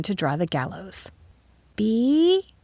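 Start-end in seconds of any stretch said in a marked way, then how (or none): 1.8-2.5 high, tentative rising question; small, high, unsure child's voice; timid. A narrator's reading. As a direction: offer a guess timidly. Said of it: "suggested timidly".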